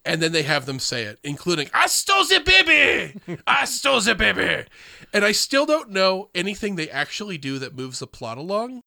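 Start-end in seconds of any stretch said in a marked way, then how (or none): 1.7-3.2 very high, squeaky mock French imp; cartoonish high French-accented squawk; helium French accent. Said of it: "doing a French accent, having inhaled helium". 3.5-4.6 higher squeaky mock French imp; cartoonish French imp voice; helium French accent. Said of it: "doing a French accent, having inhaled helium".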